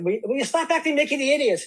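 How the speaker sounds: put-on Irish accent. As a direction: scold the children in an Irish accent. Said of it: "I can go into my Irish accent".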